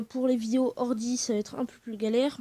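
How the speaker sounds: ordinary talk, nothing marked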